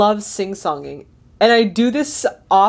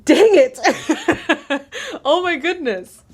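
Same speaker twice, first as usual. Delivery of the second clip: laughing exclamation; 7 semitones higher than usual. Real, not acted. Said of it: "that I'm like".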